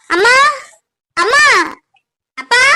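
each word rising in an anxious call; urgent calling out. Acted. Daughter calling her parents with bad news, urgent cry. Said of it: "என்னடி கிடந்து கத்திட்டு இருக்க?".

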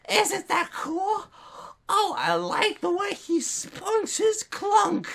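very high, slow squeal; mock admiring onlookers. Onlookers admiring an amazing display, mock squealing. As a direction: squeal admiration in a stretched-out falsetto. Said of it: "they're just like".

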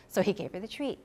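ordinary delivery

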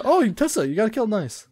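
usual speaking voice

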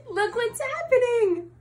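can barely get it out for laughing. Real, not acted. Reading the funny ending to the children, laughing.

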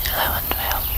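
soft whispered counting; whispering. Acted. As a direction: whisper the numbers for a hearing test. Whispered hearing test.

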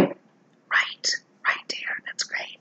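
barely audible whispered self-talk. Real, not acted. Makeup artist approving her work to herself, whispering. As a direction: whisper it under your breath. Talking to self while working.

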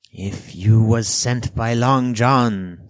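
loud, high, drawn-out alarm; alarmed castaway. A narrator's reading. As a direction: raise the voice slowly in dread. Castaway alarmed, wringing the boy's wrist.

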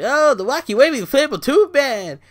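high, goofy mock commentator voice; sneering parody of a wrestling announcer; mocking Byron Saxton. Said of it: "fucking Byron Saxton".

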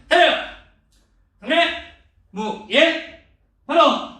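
loud, sharp drill calls; shouted commands. Real, not acted. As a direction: bark the salute commands. Taekwondo closing commands.

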